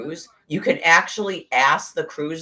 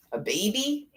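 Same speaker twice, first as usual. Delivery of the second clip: incredulous question; 7 semitones higher, 5 dB quieter than usual. Retold or imitated. Disbelief at news of baby.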